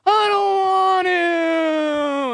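put-on whining child. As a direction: stretch it out like a sulky kid refusing. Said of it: "they do one of these".

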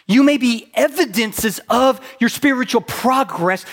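loud, driving sermon delivery; high, loud pulpit voice; preaching at full force. Acted. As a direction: preach it hard to the room.

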